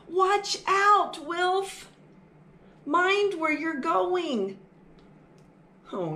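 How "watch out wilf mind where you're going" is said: mom calling out. Acted. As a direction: call out to the little mouse to watch out. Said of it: "his mom said".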